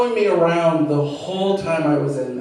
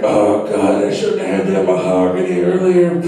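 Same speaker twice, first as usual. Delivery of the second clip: much lower, straining groan of a beaver; 13 semitones lower than usual. Acted. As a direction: sound like you're pushing hard on the toilet. Beaver voiced, straining on toilet.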